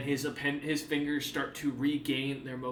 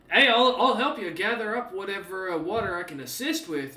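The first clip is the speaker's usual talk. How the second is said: louder, eager offer of help; friendly Hank; 5 semitones higher, 6 dB louder than usual. Acted. Hank offering to gather water, eager friendly voice. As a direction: hold out a hand and volunteer.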